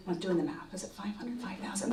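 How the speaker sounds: barely voiced, half-whispered reckoning; muttered aside. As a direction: do the sum under your breath. Muttered sum during the confrontation.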